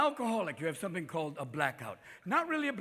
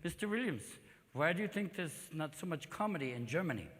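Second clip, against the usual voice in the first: put-on soft German-accented interviewer; 2 semitones lower, 8 dB quieter than usual. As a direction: ask politely in a German accent. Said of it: "on this German talk show, this woman said".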